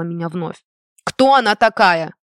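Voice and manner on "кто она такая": loud, indignant demand; outraged mother, louder. A narrator's reading. Mother demanding who the girl is, outraged. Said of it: "Возмутилась".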